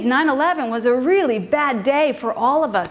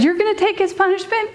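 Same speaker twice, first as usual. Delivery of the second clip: higher-pitched, rising disbelief; incredulous father; 7 semitones higher than usual. Retold or imitated. Father answering daughter who offers herself, incredulous rising voice.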